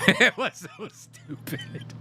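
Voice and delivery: slow words broken up by real laughter; laughing. Real, not acted. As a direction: laugh it out through the line. Laughing at soap marshmallow guy.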